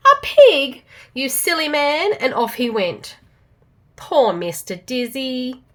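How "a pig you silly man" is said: trumpeting elephant. A narrator's reading. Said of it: "he trumpeted".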